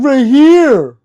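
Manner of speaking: very high, loud mock yell; triumphant retold shout. Streamer retells answering the troll, high triumphant yell. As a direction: holler it back at the troll.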